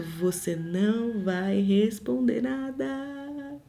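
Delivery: soft, slow, firm inner voice; hushed and deliberate, like a gentle order; the Spirit quietly holding her tongue; Holy Spirit's quiet command. Retold or imitated.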